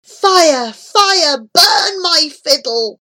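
high, loud plea to the fire; loud, drawn-out despairing call; desperate cry. A narrator's reading.

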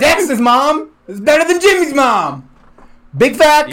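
high laughing brag. Real, not acted. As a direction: crow it while laughing.

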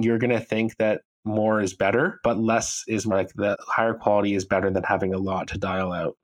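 ordinary talk

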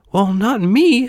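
high, protesting puppet voice; reluctant puppet. A narrator's reading. Puppet refuses fun.

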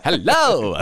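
loud high mock greeting, laughing; Jon Lovitz voice. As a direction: ham up the Lovitz hello. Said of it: "It's just Jon Lovitz voice".

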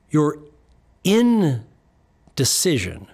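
drawn-out "indecision"; slow emphasis. Shown as a demonstration. Coach stressing the key word to clients, very slowly.

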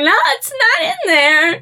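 high, whiny retold panic; panicked self. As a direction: replay her own frantic high voice. Retold panic searching for washer.

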